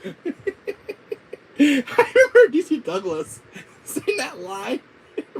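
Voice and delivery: high, loud talk through real laughter; laughing. Real, not acted. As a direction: struggle to speak while cracking up. Still laughing at the line.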